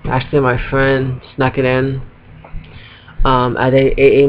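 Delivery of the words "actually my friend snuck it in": ordinary delivery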